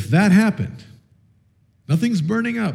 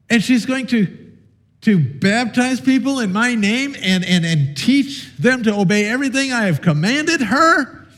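higher pitched, scandalised divine voice; mock incredulous God; 7 semitones higher than usual. Preacher voicing God outraged that Carissa goes abroad, mock incredulous. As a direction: play God aghast at a volunteer missionary. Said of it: "God is not gonna say".

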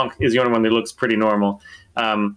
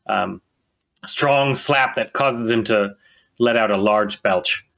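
ordinary talk

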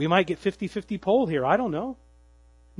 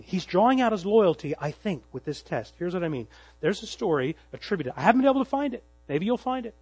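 ordinary delivery

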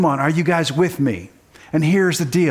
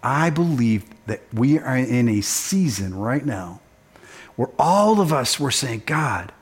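soft reflective sermon; 5 semitones lower, 4 dB quieter than usual. Acted. Pastor closing the sermon gently over music, softer. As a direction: lower and softer, intimate pastoral tone.

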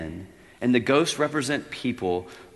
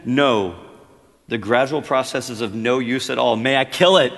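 louder, insistent and firm; firm angel; 3 semitones higher, 11 dB louder than usual. Acted. Angel firmly rejects the ghost's excuse, insistent. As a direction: cut him off firmly.

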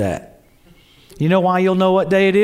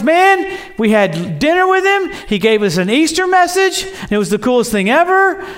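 bright, raised, eager telling of news; mock-excited buddy voice; excited retelling; 7 semitones higher than usual. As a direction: burst out with the news like a thrilled disciple. Disciples excitedly telling their buddies.

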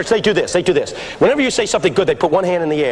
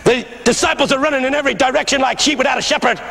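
higher, ringing pulpit cry; old pastor preaching; 6 semitones higher than usual. Retold or imitated. Old pastor answers shouts of preach, rising fervent preaching. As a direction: imitate a preacher building fervour. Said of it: "And he started".

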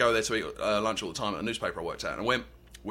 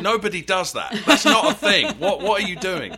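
laughing disbelief; 8 semitones higher, 7 dB louder than usual. Retold or imitated. Mocking the Americans' tea habit.

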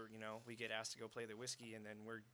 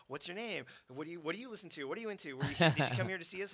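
higher, louder rapid-fire eager questions; 6 semitones higher, 12 dB louder, faster than usual. Retold or imitated. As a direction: pepper the fan with questions. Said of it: "we're standing here going".

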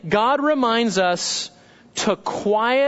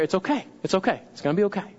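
soothing mom; 2 semitones lower, 4 dB quieter, much faster than usual. Acted. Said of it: "mom's just there".

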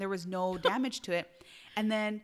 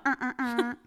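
little horn sound; 3 semitones higher than usual. Shown as a demonstration. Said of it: "You're gonna kill me with that little horn".

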